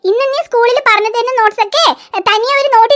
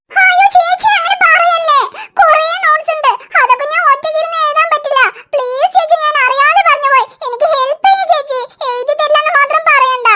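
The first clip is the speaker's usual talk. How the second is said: put-on whiny crying child. Daughter wails to mother about torn notes, tearful and whiny. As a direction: whine it out like a kid about to cry. Pleading with sister.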